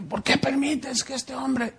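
high, soft whining complaint; retold complaint. Pastor retelling his complaint to God, high whine.

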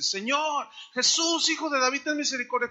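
high, pleading mock cry; acted crying out. Blind man calls to passing Jesus, acted crying out. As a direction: call out for mercy, half-shouted. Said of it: "grita un hombre que estaba ciego".

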